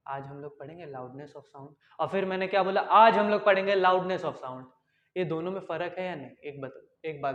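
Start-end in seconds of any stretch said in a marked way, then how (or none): 0.1-1.7 very quiet, low and slow; very soft. Shown as a demonstration. Teacher showing students low loudness, speaking very softly. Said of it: "जो मैंने एकदम धीरे से बोला". 2.8-4.6 loud, slow, forceful projection; speaking loudly. Shown as a demonstration. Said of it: "जब मैं ज़्यादा ज़ोर से बोलूँगा".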